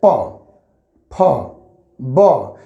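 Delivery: प, फ, ब each spoken alone, low and deliberate, lips pressing shut; lips-pressed consonants. Shown as a demonstration. Hindi teacher demonstrating lip sounds to students, low and careful. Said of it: "देखिए".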